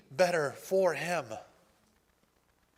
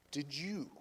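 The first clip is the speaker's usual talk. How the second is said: God's quiet question; 2 semitones lower, 11 dB quieter than usual. Retold or imitated. The Lord answering the angry driver, quietly. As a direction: say it softly and let it land. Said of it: "that's when the Lord said".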